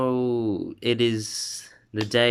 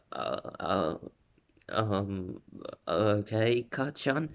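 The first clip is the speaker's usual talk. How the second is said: lost for words; 6 dB quieter, slower than usual. Acted. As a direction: fumble through hesitant fillers to an unsure reply. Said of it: "he's literally lost for words".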